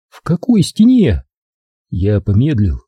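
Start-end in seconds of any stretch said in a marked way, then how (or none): 0.1-1.2 high-pitched, puzzled question; high, wary query; wary captive woman. A narrator's reading. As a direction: question him in a raised woman's voice.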